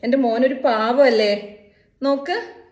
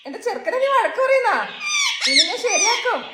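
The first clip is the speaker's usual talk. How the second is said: laughing mock scold; 8 semitones higher than usual.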